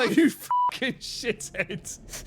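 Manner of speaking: high, drawn-out curse; slow, high-pitched outraged swearing; exasperated cursing. Real, not acted. Swearing at enemy that scared him.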